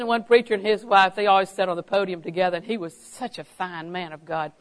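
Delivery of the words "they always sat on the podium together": usual speaking voice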